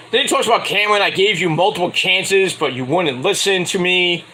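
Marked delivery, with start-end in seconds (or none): none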